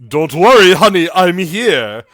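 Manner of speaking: loud, cheerful call from the door. Acted. Aaron calls to the mother from the door, loud and cheerful. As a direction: announce your arrival loudly. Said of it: "you hear".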